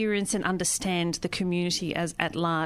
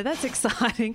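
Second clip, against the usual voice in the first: ordinary talk, nothing marked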